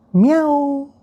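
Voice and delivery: high squeaky meow; cat meow. Acted. Partner answers with cat sound.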